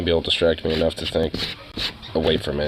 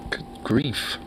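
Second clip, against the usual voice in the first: whispered; 5 semitones higher, 6 dB quieter than usual. Real, not acted. Muttered exclamation.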